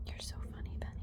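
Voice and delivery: soft unvoiced whisper. Real, not acted. Whispering fondly to Penny the horse. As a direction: breathe it softly to the horse.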